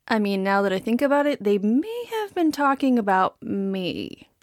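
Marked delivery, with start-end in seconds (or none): none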